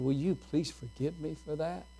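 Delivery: soft, gentle father asking forgiveness; tender apology. Retold or imitated. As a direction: say it softly to a hurt child. Father apologizing to little daughter.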